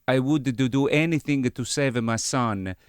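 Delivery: earnest movie-dad impression; film character impression. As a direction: plead for a sick son in a put-on accent. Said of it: "what film is this voice from".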